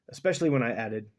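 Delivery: ordinary talk, nothing marked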